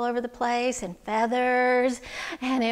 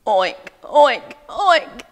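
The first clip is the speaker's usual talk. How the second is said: loud, punchy imitated oinks; pig oinking; 8 dB louder, much slower than usual. Storyteller showing children pig sounds, oinking. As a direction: oink out sharply like a pig. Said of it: "what sound does a pig make".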